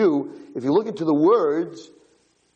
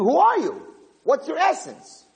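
urgent demand. Retold or imitated. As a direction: demand the answer with rising force. Yaakov demands the angel's essence.

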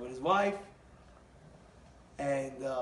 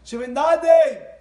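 high, drawn-out shout; booming ceremonial announcement; proclaimed pledge; 8 semitones higher, 14 dB louder, slower than usual. Retold or imitated. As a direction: call it out slowly to the whole congregation. Gabbai calling out the pledge.

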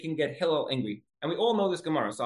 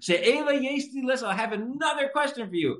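imitating the pest; 10 semitones higher than usual. Man stalling to provoke Hillel, high singsong. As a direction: draw it out high to test someone's patience. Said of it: "But he's like".

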